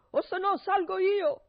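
loud, high threatening shout; angry yell. Acted. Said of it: "urlava Barbablù".

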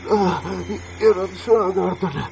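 loud, high desperate insistence; frantic protest. Acted. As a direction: plead urgently, they've got the wrong man. Protesting mistaken identity.

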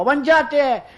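high-pitched, insistent director's call; director shouting. Acted. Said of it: "il regista gridava".

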